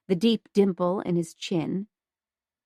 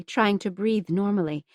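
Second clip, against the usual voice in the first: plain reading voice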